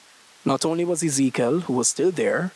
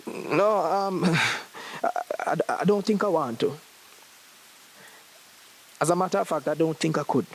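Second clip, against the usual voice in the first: higher, hesitant, faltering voice; nervous; 4 semitones higher than usual. Acted. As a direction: stammer it out like a nervous young man. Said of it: "Ijamar swallowed nervously".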